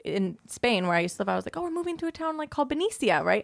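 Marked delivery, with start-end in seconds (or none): 1.6-3.2 put-on higher, light kid voice; high-pitched imitation of a younger self; younger self retold. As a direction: chirp it like an excited kid telling friends.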